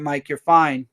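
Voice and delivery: usual speaking voice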